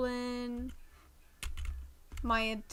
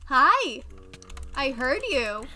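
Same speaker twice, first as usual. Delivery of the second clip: condescending voice; 2 semitones higher, 7 dB louder than usual. Acted. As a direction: talk down to them with fake sweetness. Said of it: "that was the most condescending, like, voice I could muster".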